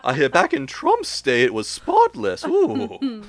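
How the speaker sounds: slow, prim gossiping maid; gossipy maid voice. Pretend. Joining the maid bit to gossip about Trump's day. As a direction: gossip like a fussy old servant.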